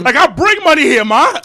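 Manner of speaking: high, loud mock outrage; indignant retort. Clubgoer's unspoken comeback to the door woman, indignant. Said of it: "in my head, I'm saying that".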